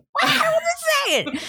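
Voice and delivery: high laughing mock protest; mock outraged yell. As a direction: yelp it in fake outrage. Mock outrage at husband's remark.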